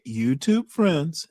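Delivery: slow, sarcastic echo. Real, not acted. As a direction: drawl it back with scorn.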